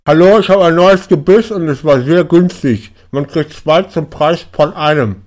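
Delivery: talking through fake vampire teeth; slurring through cheap dentures; caveman voice. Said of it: "Hallo, Caveman".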